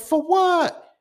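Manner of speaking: very high, loud disbelieving yelp; incredulous shriek. Retold or imitated. Asking his friend why he fought, shocked. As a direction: squeak it out in disbelief. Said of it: "I said".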